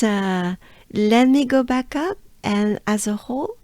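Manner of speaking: usual speaking voice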